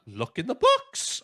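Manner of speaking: high-pitched mock realisation; kid's incredulous echo. Boy repeating the old man's advice, high mock realisation. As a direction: echo it back high, as a dawning idea. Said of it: "And he's like".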